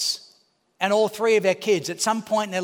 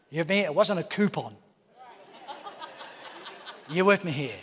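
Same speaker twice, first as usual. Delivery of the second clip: ordinary talk, nothing marked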